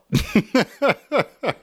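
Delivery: a burst of high giggling; high laugh. Real, not acted. Teacher cracking up after playing angry. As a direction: laugh at your own performance.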